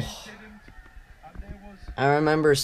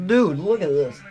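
usual speaking voice

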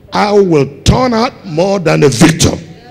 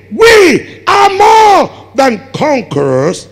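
preached slow shout; 6 semitones higher, much slower than usual. Real, not acted. Preacher quotes Paul emphatically.

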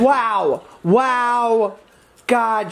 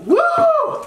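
high whoop. Real, not acted. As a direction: let out a whoop. Reacting to spice.

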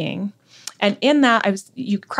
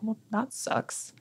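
resigned deadpan; 12 dB quieter, much slower than usual. Retold or imitated. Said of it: "And I was like".

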